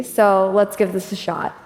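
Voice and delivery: ordinary talk, nothing marked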